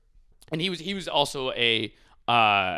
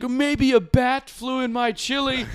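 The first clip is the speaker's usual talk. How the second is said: higher, slow put-on dopey voice; a silly, dopey bystander, slow and pitched up; goofy bystander voice; 11 semitones higher, slower than usual. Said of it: "And someone's like".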